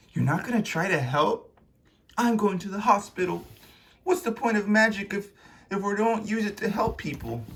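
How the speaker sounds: high-pitched earnest challenge; indignant Luna. Acted. Luna challenges her elder.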